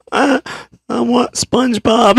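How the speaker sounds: high-pitched imitation of a demanding child; mock kiddie pleading; little kid's whine. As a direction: whine it like a small boy. Screaming child's demand retold.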